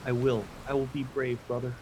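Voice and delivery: small, soft brave reply; sniffly sister. Acted. Sister wiping her face, promising brother, softly brave. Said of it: "sniffs a little bit".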